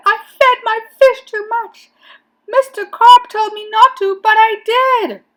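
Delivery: high, loud, urgent wail; panicked confession. A narrator's reading. Boy confessing to the policeman by phone, high panic. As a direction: blurt it out in alarm.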